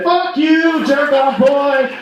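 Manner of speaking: high, drawn-out jeering call; mock street taunt. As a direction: imitate someone heckling him down the street. Strangers taunt him on street.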